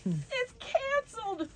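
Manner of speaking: high, slow mock wail; mother wailing over cancelled story time. A narrator's reading. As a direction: stretch it into a theatrical wail. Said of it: "wailed Ava's mother".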